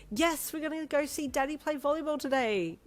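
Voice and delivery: high, bright, excited reply. Acted. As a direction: share the good news eagerly. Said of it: "you said excitedly to her".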